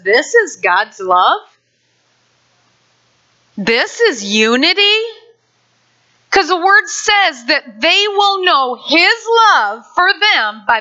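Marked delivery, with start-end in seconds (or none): 0.0-5.0 mocking disbelief. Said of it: "the world looks at us and says".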